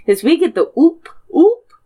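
high loud oop; a sharp high Canadian oop. Shown as a demonstration. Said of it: "we get that from them".

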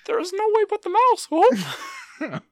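loud, very high squeaky mouse; a villainous squeaking cartoon mouse; Mickey Mouse voice. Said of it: "Mickey Mouse Voice".

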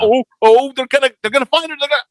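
panicky. Retold or imitated. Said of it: "it's like".

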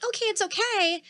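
high-pitched mock soothing; placating. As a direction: soothe him in a high little voice. Imagines herself placating the crying customer.

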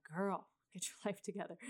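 a light sassy voice for the saint, laughing. Retold or imitated. Saint scolding the frazzled mother, lightly sassy and laughing. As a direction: tease like an exasperated friend. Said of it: "Saint Anthony's probably always up there like".

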